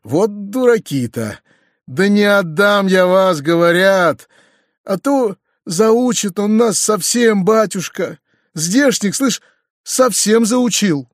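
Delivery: exasperated exclaiming. A narrator's reading. Uncle and peasants argue over the master, mild exasperation. Figures' dialogue in narration.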